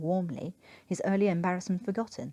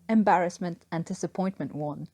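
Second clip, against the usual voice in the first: ordinary delivery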